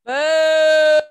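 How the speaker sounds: loud booing at the villain; booing the villain Haman. Real, not acted. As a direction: boo Haman loudly. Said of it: "stamp your feet and say".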